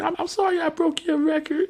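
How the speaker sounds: put-on high, halting child's apology; Brianna. Little girl confessing a broken record, imitated piping voice. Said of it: "She's like".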